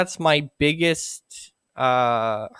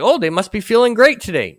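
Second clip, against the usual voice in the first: high, quick, chirpy assumption; breezy, higher-pitched mimicry; mock onlooker; 9 semitones higher, 4 dB louder, faster than usual.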